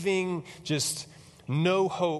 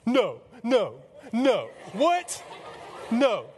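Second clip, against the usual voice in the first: acted hysterical disbelief, each word punched out; freaking out; 7 semitones higher, much slower than usual. Mary seeing Jesus alive, freaking out. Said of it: "she just freaks the junk out".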